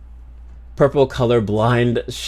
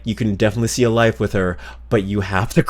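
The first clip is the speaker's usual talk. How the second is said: ordinary talk, nothing marked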